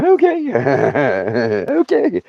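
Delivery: very high, sheepish okay; meek okay. Retold or imitated. Scolded grandson answers grandma with a squeaky sheepish okay.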